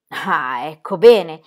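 loud relieved exclamation; exclaimed. A narrator's reading. Midwife relieved the baby finally cries, exclaiming. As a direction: burst out with relief. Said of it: "esclamò Frau Dosch".

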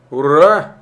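loud delighted cry of hurray; joyful shout. A narrator's reading. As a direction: shout it out with joy, making an echo. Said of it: "восторженно закричал он".

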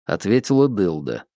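plain reading voice

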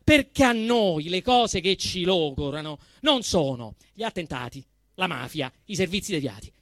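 normal talking voice

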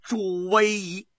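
high, slow, resounding declamation; loud, ringing voice. A narrator's reading. Lin Zexu cries out into the storm, loud ringing proclamation. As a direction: proclaim it loudly, drawing out each word. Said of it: "朗声地说道".

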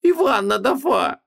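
high, slow, lisping cry; tongue-tied wife. A narrator's reading. Wife explaining the diadem, lisping and high-pitched. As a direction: answer thickly through a bitten tongue.

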